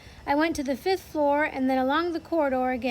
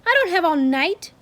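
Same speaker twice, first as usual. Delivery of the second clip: louder, curt scolding; impatient snapping; 4 semitones higher, 8 dB louder than usual. Acted. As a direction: hurry them in with a scold. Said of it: "snapped the Grand High Witch".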